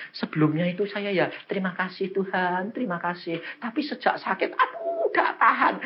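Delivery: high-pitched imitation of a whimpering patient; mock a plaintive moan, jumping high on the pain; tearful complaint. Woman telling preacher of her pain, mock tearful complaint. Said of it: "Dia nangis. Dia bilang".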